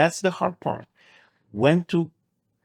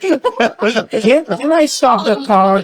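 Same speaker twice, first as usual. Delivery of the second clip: high, loud and flustered, begging to stop the car; mock panic; 8 semitones higher, 7 dB louder, slower than usual. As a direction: play it like a trapped passenger pleading for air.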